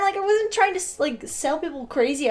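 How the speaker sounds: usual speaking voice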